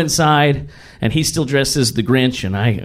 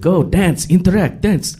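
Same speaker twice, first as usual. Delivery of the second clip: put-on Russian accent; 3 semitones higher than usual. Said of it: "I do his accent sometimes".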